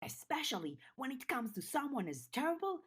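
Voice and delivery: gleeful taunt from a tiny beast; Snowdrop shouting. Acted. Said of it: "Snowdrop shouted".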